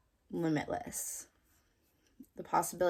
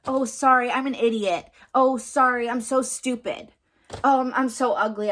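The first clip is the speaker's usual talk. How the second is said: higher, louder example of putting oneself down; mock self-deprecation; 5 semitones higher, 6 dB louder than usual. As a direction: act out beating yourself up, pitched up. Mimicking self-deprecating remarks as examples.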